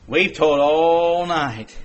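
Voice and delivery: slow, low tired fisherman voice; lightly voiced weary fisherman; Peter's weary reply. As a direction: play Peter, worn out after a long night. Peter voiced in sermon retelling.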